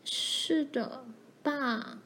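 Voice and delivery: softly acted tearful admission; close to tears. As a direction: answer meekly, as if about to cry. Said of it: "玛丽快哭了出来".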